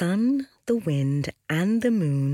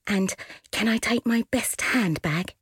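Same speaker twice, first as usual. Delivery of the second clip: put-on eager young sister voice; 6 semitones higher than usual. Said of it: "asked the wind".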